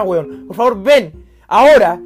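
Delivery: loud, slow, drawn-out demand; desperate shout. Retold or imitated. Begging the police to come right now, loud slow shout. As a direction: bellow each word slowly down the phone.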